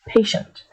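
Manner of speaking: "patient" said slowly and clearly, PAY-shunt; slow pronunciation. Shown as a demonstration. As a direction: sound out "patient" carefully. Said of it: "So say that slowly".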